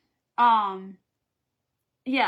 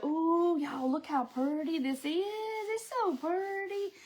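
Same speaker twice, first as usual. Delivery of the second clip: high, breathy-free gush of delight; gushing over fabric; 5 semitones higher, 3 dB quieter, slower than usual. Retold or imitated. Shopper in the store gushing over fabric, delighted coo. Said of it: "you can't walk in the store and go".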